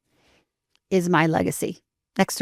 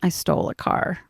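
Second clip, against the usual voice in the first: hushed, voiceless retold admission; ashamed confession. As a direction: confess it under your breath, imitating a shamed teen. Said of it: "puts his head down in total shame".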